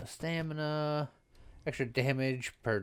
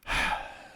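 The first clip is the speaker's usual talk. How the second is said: long exaggerated sigh; dramatic sigh. Real, not acted. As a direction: heave a big theatrical sigh. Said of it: "You, you can't give me dramatic sigh every time".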